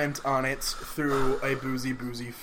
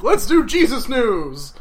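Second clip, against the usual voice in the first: mock show title; 8 semitones higher, 8 dB louder, slower than usual. Pitching a joke show title.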